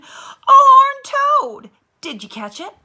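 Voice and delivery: very high, very slow, loud delighted cry; delighted teacher. A narrator's reading. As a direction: stretch it out in wonder.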